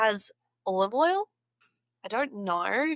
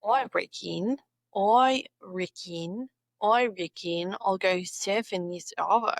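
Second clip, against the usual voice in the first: slow, deliberate Aussie "oi reckon"; broad Aussie with "I" as "oi"; Australian accent; 2 semitones lower than usual. Shown as a demonstration. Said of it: "words that end with".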